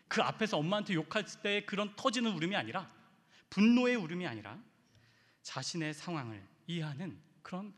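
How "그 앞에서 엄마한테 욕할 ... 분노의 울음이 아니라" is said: loud, fervent preaching; impassioned. Real, not acted. 울분이 아닌 울음을 설명하며 열정적으로. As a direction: build the sermon to a passionate peak. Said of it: "impassioned preaching climax".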